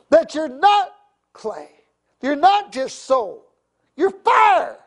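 high, loud, slow preacher's call; fiery preaching. Real, not acted. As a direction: rouse the church, slow and loud. Preaching at full force.

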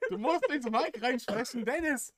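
loud, high exasperated yell; exasperated shout. Real, not acted. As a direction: shout the order across the room. Repeating the instruction louder.

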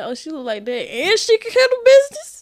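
high, squealing disbelief; surprised. Retold or imitated. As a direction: gasp like you can't believe it. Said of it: "They be like".

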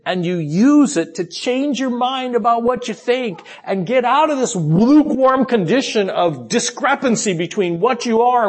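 high, urgent exhortation in full flow; impassioned preaching. Acted. Preacher voicing Christ's call to repent, impassioned. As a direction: exhort them with rising fervor.

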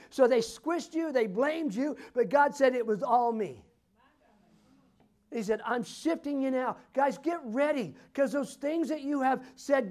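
high, loud, forceful preaching; impassioned prophetic preaching. Real, not acted. Preacher prophesying over a couple about their anointing, impassioned preaching.

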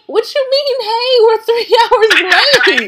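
high, loud, laughing disbelief; incredulous outrage. Retold or imitated. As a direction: blurt it out in shocked disbelief. Retelling her outrage at the lateness.

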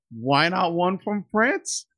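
usual speaking voice

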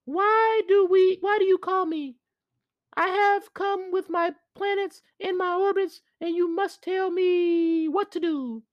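very high, eerie spirit voice; a far-off high otherworldly voice; South spirit voice. Acted. South orbit questions the sun.

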